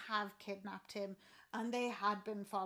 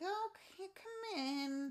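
wary; 10 semitones higher, much slower than usual. Retold or imitated. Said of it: "being a bit like".

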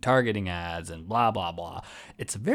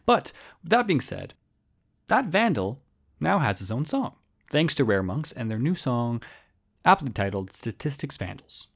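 ordinary talk, nothing marked

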